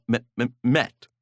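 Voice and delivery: ordinary delivery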